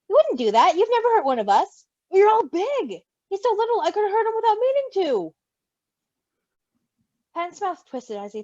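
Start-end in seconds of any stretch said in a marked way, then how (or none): 0.1-5.3 reassuring Patton, lifted higher voice; gentle Patton, voiced higher. Patton reassures Roman, in a higher gentle voice. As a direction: soothe the worried friend from a higher pitch.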